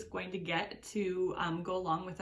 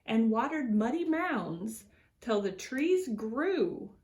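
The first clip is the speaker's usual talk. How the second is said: put-on reading to children. A narrator's reading. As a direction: read it like story time for little ones. Reads picture book to children.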